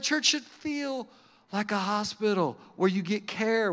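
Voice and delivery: usual speaking voice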